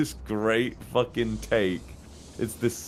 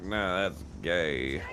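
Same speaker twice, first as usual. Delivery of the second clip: slow dismissive drawl; 2 semitones lower, 4 dB quieter, much slower than usual. Retold or imitated. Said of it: "but Kramer's like".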